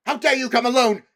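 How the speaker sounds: high, loud furious witch; terrible voice. Acted. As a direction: blast him with cold fury. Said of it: "said the witch in a terrible voice".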